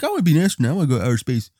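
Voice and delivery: put-on high eager kid voice; eager child. Young self telling adults his dream, eager high voice. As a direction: declare your dream like a small kid. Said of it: "I'm like".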